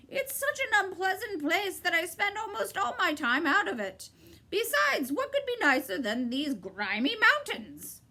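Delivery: high, clipped bird's reply; sharp bird voice. A narrator's reading. Bird cutting Milo off, high and sharp. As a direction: snap it out like a bird.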